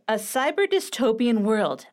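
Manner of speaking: normal talking voice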